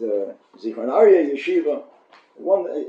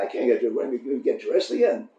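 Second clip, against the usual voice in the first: normal talking voice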